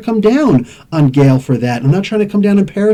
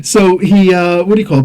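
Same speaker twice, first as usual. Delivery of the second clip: normal talking voice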